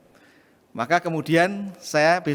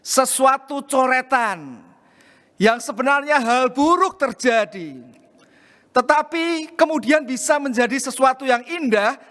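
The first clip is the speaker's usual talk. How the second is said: raised, fervent sermon appeal; impassioned preaching; 5 semitones higher than usual. Acted. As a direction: press the lesson home to the congregation. Applies story in a raised preaching voice.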